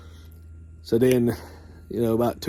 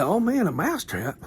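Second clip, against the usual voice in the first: high squeaky mouse voice, dismayed; a small mouse groaning at the trap; worried little mouse; 8 semitones higher than usual. Retold or imitated. Mouse sees trap, high mouse voice.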